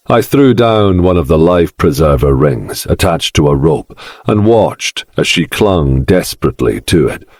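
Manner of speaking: ordinary delivery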